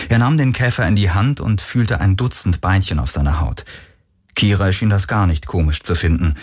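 plain reading voice

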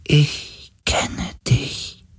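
high, very slow, half-whispered spirit voice; dying being's voice. A narrator's reading. The dying sea spirit recognises Galen, in a weak, airy whisper. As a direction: let each word drift out faintly. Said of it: "Die Stimme eines sterbenden Wesens".

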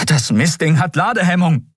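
high-pitched, exasperated outburst; alarmed exclamation. A narrator's reading. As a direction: call out the problem urgently. Kai cries out about jammed weapon.

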